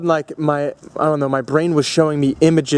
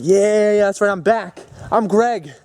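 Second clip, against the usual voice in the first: retold elation, raised pitch; high-pitched triumphant self-affirmation; happy and excited; 6 semitones higher than usual. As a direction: relive the joy of being back. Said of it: "I was so happy and excited".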